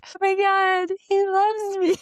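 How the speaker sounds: high, loud mock gush; mock swooning. Mocking the other woman in therapy, high mock gush. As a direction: gush it like a fooled fan.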